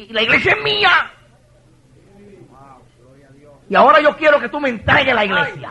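high, loud divine demand; commanding shout. Acted. God demanding the church from the pastor, commanding shout.

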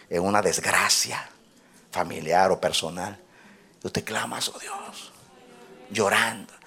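voz baja y grave, pausada; prédica íntima. Real, not acted. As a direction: habla suave y recogido, como confidencia. (prédica en voz baja y solemne)

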